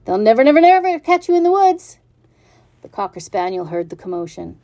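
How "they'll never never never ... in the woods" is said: very high, loud goose shout; hollering goose. Acted. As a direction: holler at the pig to flee. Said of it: "she too started hollering".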